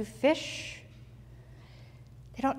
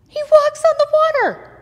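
much higher and louder, ringing with wonder; amazed exclamation; 11 dB louder than usual. A narrator's reading. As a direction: cry it out in awe. Marveling at the miracle.

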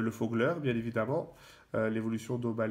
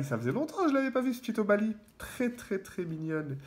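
much higher, quick delighted coo; cooing over a card; 7 semitones higher than usual. Real, not acted. YouTuber spots a cute card he missed, high delighted coo. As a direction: gush over the little Pokémon like a pet.

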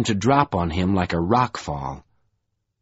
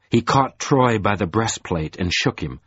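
ordinary delivery, nothing marked

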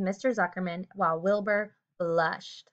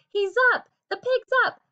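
crowd yelling; 7 semitones higher, 5 dB louder than usual. Acted. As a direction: shout your joy at the fair. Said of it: "yelled the crowd".

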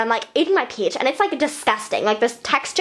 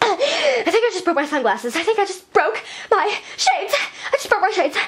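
mock distress; 7 semitones higher than usual. Distress over broken sunglasses.

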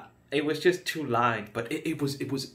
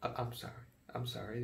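much softer sudden apology; hushed apology; 13 dB quieter, much faster than usual. Acted. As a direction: drop to almost nothing.

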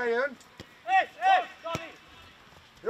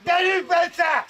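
shouted encouragement. Real, not acted. Coach spurs on Bence, shouting. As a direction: urge the player on from afar.

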